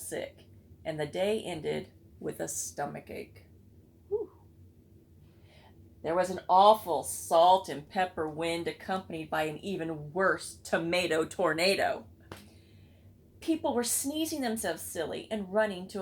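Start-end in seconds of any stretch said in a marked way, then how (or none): none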